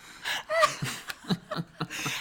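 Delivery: airy squeal of a laugh; high breathy laugh. Real, not acted. Laughing at Wig Zaddy staring her down, airy squeal.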